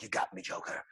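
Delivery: soft, low, grim surrender; low Batman voice. Acted. Batman walks in to face Joker, low surrender. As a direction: drop right down and say it quietly.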